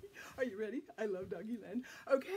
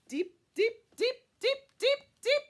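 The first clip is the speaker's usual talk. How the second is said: building up; 12 semitones higher, 10 dB louder than usual. Pretend. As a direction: build the suspense to a peak. Building suspense reaching deep.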